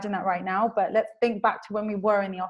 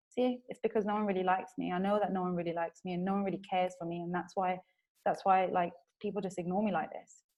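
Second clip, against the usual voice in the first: deflated, softer mock sulk; dejected; 2 semitones lower, 6 dB quieter than usual. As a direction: sink into quiet hurt, lightly acted. Acted thought of feeling unliked.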